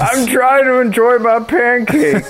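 put-on high, slow cranky old-timer; grumpy old man. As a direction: whine it like a crabby old man. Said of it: "channel my inner near thirty-year-old".

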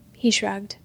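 usual speaking voice

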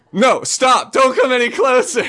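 high, loud urgent warning; alarmed shout. Retold or imitated. Kid stuck in mud warns best friend back, shouting. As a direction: yell to halt someone in their tracks.

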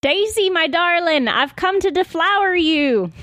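high, sing-out mock-romantic call; theatrical suitor. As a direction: call out to a darling, pitched high. Player calls theatrically to Daisy.

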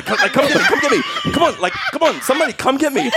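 high, urgent retold calling out; mock yelling for rescue. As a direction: call for rescue in a high mock yell, half laughing. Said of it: "yelling at the Jedi".

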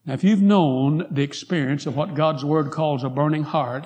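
ordinary delivery

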